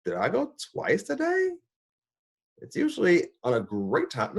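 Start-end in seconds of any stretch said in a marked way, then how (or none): 0.0-1.5 much higher, delighted disbelief; amazed. Retold or imitated. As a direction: ask it in happy astonishment. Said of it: "it was like".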